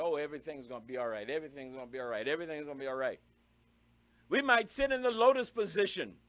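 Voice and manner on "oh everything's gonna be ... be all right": rattled off fast, softer and lower, mocking; mock mantra. Mocking self-help mantras to the church, quick soft mock chant. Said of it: "recite mantra".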